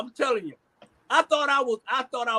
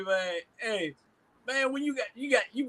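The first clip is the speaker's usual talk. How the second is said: ordinary talk, nothing marked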